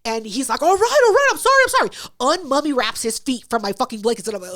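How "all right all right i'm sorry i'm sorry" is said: high, loud frantic apology; panicked apology. Retold or imitated. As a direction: squeal out a scared sorry.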